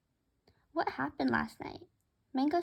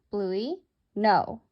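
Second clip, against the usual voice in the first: louder, low firm telling-off; firm no to pet; 3 semitones lower, 6 dB louder than usual. Retold or imitated. Narrator tells Bluey off for biting Mango's foot, firm loud no. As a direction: stop the bird with one firm word. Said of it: "I said".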